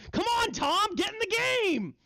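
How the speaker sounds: high squeaky goblin voice, pushy; goading hobgoblin. Acted. Hobgoblin urging the other hobgoblin to fight, squeaky goading voice. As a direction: egg your buddy on in a squeaky goblin pitch.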